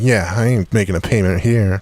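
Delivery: ordinary delivery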